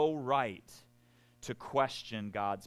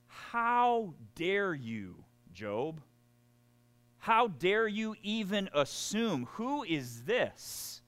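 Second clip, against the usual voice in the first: higher, slow, scolding God voice; indignant God; 7 semitones higher than usual. Acted. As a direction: rebuke Job as an outraged God. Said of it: "What's God saying?".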